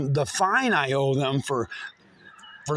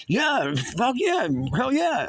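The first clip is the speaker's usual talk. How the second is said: higher, slow, thrilled agreement; eager yes; 8 semitones higher, slower than usual. Retold or imitated. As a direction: stretch it out, fired up. His eager reply to the voice.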